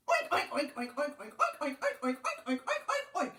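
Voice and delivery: rapid string of high, loud oinks; pig oinking. A narrator's reading. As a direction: oink away as if explaining. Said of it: "He said".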